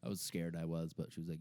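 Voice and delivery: ordinary delivery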